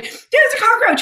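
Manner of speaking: high-pitched retold squeal; mock panic. Telling roommate about the roach, high mock panic. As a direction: squeak it out in comic alarm.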